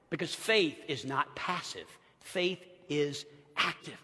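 quiet, deliberate sermon point; hushed emphasis. Acted. Preacher hushing the congregation to stress faith's action. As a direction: drop it low and soft, slowing on faith is active.

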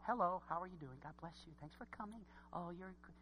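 mocking a soft, mild preacher greeting; soft polite preacher. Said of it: "He's not talking like you sometimes like preachers to talk".

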